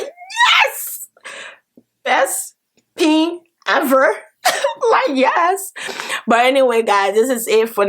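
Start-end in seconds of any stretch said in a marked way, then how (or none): 0.0-4.2 ecstatic cheer. Real, not acted. Telling viewers about her dream, an ecstatic high cheer. 4.4-5.7 laughing yes. Real, not acted. Laughs after cheering.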